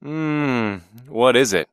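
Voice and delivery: groggy hum into a question; sleepy. A narrator's reading. Boy woken very early by his dad, sleepy. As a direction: answer as if just woken. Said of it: "I felt very sleepy".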